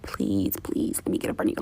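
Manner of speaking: fast, whispered pleading; begging whisper. Retold or imitated. Begging the person ahead in line, whispered and fast. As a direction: beg a stranger in a hurry.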